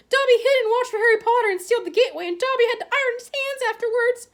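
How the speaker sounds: high, squeaky, loud elf voice near tears; tearful house elf. A narrator's reading. House elf reproaching Harry, squeaky and tearful. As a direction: plead like a weeping servant elf.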